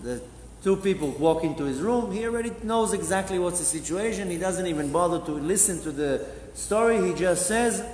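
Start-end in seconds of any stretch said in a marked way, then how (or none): none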